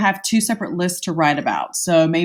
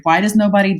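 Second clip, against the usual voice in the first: ordinary delivery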